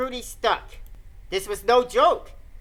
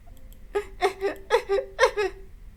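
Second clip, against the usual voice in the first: put-on high eerie little-girl giggle; creepy giggle; 10 semitones higher than usual. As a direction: giggle like a ghost child. Ghost girl Sachiko appears.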